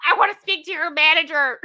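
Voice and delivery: high, loud mock Karen demand. Mocking the aunt as a Karen, hammed-up. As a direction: play the classic Karen.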